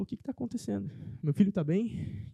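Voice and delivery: high, anxious asking, slowing at the end; mother anxiously asking the doctors about her son; worried mother. Retold or imitated. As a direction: plead for news of her son.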